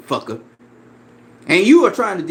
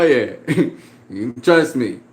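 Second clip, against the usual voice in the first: ordinary delivery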